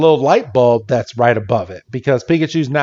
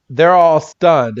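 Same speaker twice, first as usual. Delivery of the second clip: usual speaking voice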